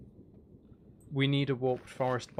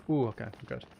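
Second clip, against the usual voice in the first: usual speaking voice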